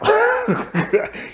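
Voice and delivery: bursting into a high-pitched laugh; a squealing laugh at the silly scene. Real, not acted. Laughing at the boyfriend fleeing.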